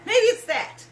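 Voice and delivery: emphatic climax. Real, not acted. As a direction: slam the last line home. Rant builds to a climax.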